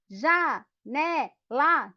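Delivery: "Já, né, lá" split by clear pauses; syllable by syllable. Shown as a demonstration. Teacher clapping out janela for the class, syllable by syllable. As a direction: clap out "Já, né, lá" one beat at a time. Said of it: "Com o pé".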